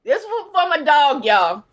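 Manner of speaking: loud, grieving cry; voice about to crack. Real, not acted. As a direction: wail it out, mourning your dog. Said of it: "My voice is probably gonna start cracking".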